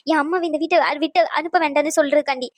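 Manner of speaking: usual speaking voice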